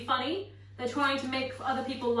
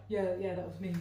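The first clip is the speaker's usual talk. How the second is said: mock classmates; 7 semitones lower, 8 dB quieter than usual. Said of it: "other people will be like".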